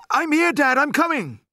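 very high, loud eager shout; calling back. A narrator's reading. Boy running to his father, calling back. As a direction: shout that help is on the way.

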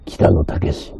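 low, loud, slow trailer narration; deep movie trailer voice. Shown as a demonstration. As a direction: boom it out like a blockbuster trailer. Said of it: "映画っぽい音".

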